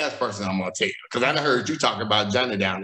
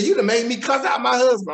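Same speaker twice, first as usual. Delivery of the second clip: high, loud, exasperated friend; friend's indignant report; 10 semitones higher, 4 dB louder than usual. Retold or imitated. Friend says she cussed out her husband, high and exasperated. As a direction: throw it at him like she's fed up.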